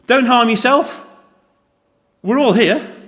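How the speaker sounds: loud, high cry to stop him; urgent, high, loud shout; shouted call. Acted. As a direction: shout to stop a man about to fall on his sword. Paul shouts to the jailer.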